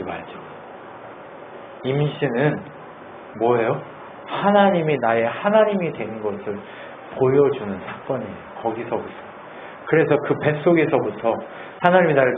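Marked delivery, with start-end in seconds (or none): none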